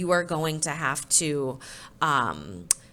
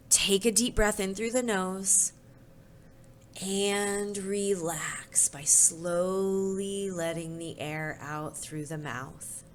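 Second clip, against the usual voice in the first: slow, soft breathing cue, fading out; calming teacher voice; guided breathing; 4 dB quieter, slower than usual. Acted. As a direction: guide the class into calm, slowly.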